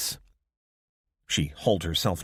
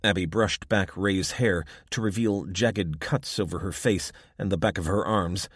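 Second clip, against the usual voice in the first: plain reading voice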